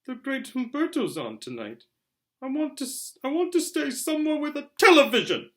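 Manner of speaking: put-on high, loud whining child; whiny spoiled boy. A narrator's reading. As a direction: whine like a spoiled kid. Dudley whining for television.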